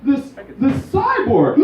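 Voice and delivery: prissy daughter voice, rising outrage; proper daughter. Acted. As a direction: act the prim daughter scandalized by the date. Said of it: "so much more proper than I am".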